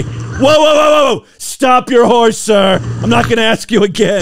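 high, urgent mock cop commands; mock police officer. Host imitates police ordering a horse stopped, mock commanding. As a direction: call out like a cop halting a buggy. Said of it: "Things you'll only hear them say".

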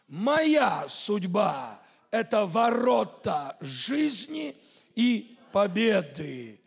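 normal talking voice

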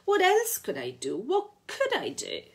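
half-laughing shriek of despair. A narrator's reading. Lou to Raymond on the money, a light hysterical shriek. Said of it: "Lou shrieked".

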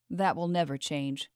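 plain reading voice

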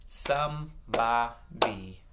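"Somebody" broken into separate beats; "Somebody" slowly segmented for blending; slow syllables. Shown as a demonstration. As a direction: clap out "Somebody" slowly, pausing between syllables. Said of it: "I'm gonna say them slow and clap".

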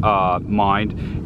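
usual speaking voice

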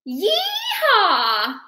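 loud yeehaw shout; cowboy whoop. Acted. Eraser returns triumphantly.

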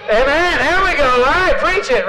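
high, loud amen call; playful preacher shout. Pretend. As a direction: whoop it up like a revival preacher.

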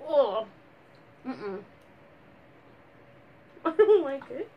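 usual speaking voice

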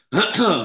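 a rough throat clear before going on. Real, not acted. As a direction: clear the throat hard, voice worn from yelling. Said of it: "losing my voice here".

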